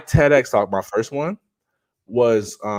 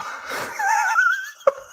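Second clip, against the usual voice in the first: laugh; 3 dB quieter than usual. Real, not acted. Laughs about wife in room.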